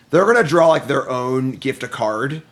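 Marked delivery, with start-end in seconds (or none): none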